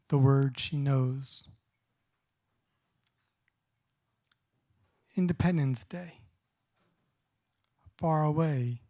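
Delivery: measured spoken-word poem delivery with long pauses; unhurried poet at a reading, every line weighed; poetry reading. Acted. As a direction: read each line like a poem, letting the pauses breathe. Reads poems aloud.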